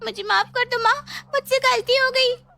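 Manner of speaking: high, desperate begging; frightened pleading. Acted. Daughter begs her ghost mother for forgiveness in panic.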